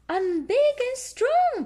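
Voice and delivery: very high, slow, loud piglet boast; Peppa voice. A narrator's reading. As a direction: boast like a proud little pig girl. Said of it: "Peppa says".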